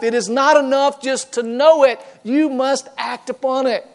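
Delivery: normal talking voice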